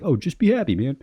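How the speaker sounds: mock someone who thinks it's that easy; mock glib advice. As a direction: make the easy fix sound silly. Mocks glib advice to the angry.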